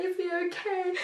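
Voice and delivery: mother asking nurses if her premature son is okay; tearful. Real, not acted. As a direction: ask it through crying. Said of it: "I'm getting emotional now".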